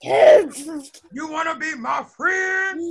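loud, drawn-out mock-menacing invitation; unfriendly approach. Shown as a demonstration. Teacher showing pupils how not to ask for friends, exaggerated unfriendly. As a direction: stretch it out loudly, off-putting. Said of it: "Should we look at them like this?".

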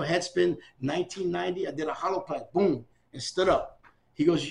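ordinary talk

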